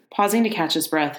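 plain reading voice

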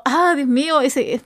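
ordinary talk

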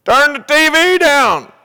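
imitated mother's high, loud, drawn-out yell; stretched-out, high-pitched parental shout; mom's yell. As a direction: holler it slowly at the kid as the mom. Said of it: "mom yells again".

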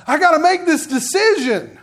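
high, loud, agonized wail of words; hammed-up anguish over a heavy decision; mock despair. Preacher acting out agony over deciding alone, despairing. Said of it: "the despair and the, and the agony".

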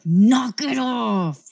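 slow, stern warning; firm mom warning, drawn out. Retold or imitated. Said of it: "I was like".

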